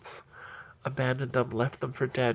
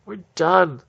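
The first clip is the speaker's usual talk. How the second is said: higher, loud yell; full mock yell; 4 semitones higher, 10 dB louder than usual. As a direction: yell it at the top of your lungs. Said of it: "throw up their hands and go".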